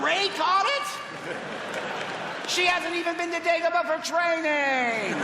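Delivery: high, slow, laughing disbelief; mock indignation. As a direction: play it as a fan's outraged squeal. Mock outrage at Rey catching lightsaber.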